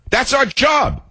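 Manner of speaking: high, over-the-edge outburst; worked-up insistence. Real, not acted. Host rants on the press's duty, worked up and high. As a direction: pitch it way up, exasperated. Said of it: "something in my voice must have gotten to him".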